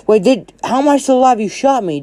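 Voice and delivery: high-pitched, stumbling disbelief; bewildered. Acted. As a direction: protest in a high, baffled voice. Monoma baffled he is alive.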